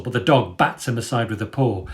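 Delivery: ordinary delivery, nothing marked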